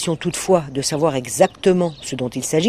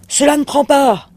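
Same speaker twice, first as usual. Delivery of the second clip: cri aigu et fort, plus haut; hurle-le, furieux; cri du monstre; 10 semitones higher, 4 dB louder than usual. A narrator's reading. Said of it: "hurla le monstre". (le monstre hurle sur Arthur, crié fort)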